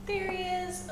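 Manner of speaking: high, delighted call of discovery; light up as you spot it; excited spotting. Real, not acted. Spotting the gecko again.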